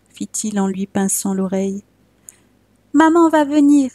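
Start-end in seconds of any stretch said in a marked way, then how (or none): 2.9-3.9 put-on high, bright little boy's voice; small child voice. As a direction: answer like an innocent little boy. Child Jean answers gendarme.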